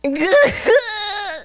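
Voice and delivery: real high-pitched cackle, almost crying; high squealing laugh. Real, not acted. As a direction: burst into a high, helpless laugh. Both crack up at a joke.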